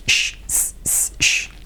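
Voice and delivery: unvoiced "Sh, s, s, sh", each sound separate; isolated sh and s. Shown as a demonstration. Teacher breaking a phrase into its sounds, isolated sh and s. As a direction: hold "sh" and "s" as pure breath sounds.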